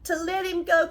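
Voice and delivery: ordinary delivery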